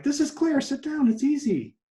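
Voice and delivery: high, emphatic, half-laughing urging; urging a stubborn woman to sit down; exasperated inner voice. Retold or imitated. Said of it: "I'm like".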